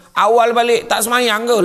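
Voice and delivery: mock nagging wife; angry wife. Wife accusing husband home early of skipping prayer, cross. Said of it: "bini marah lagi".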